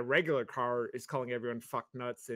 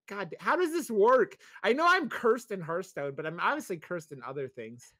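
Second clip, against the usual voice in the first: higher, louder frustrated outburst at the game; mock rage; 6 semitones higher, 7 dB louder than usual. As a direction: vent the rage at being cursed. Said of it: "and I'm like".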